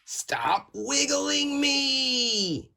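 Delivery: high slow whispered cry; tooth crying out. Acted. Little tooth begging the tongue, whispered high cry. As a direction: plead in a tiny desperate voice.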